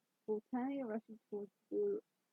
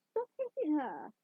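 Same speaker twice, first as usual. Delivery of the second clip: higher, slow protesting shout; 5 semitones higher, 4 dB louder, slower than usual. A narrator's reading. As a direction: yell at someone to stop. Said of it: "Angel shouted".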